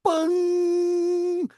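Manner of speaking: high, stretched doorbell bung; high doorbell bung said again; doorbell chime. Retold or imitated. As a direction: ring it out like the door chime. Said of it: "world's loudest doorbell".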